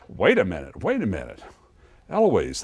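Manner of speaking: usual speaking voice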